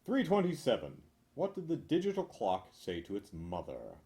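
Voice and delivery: ordinary talk, nothing marked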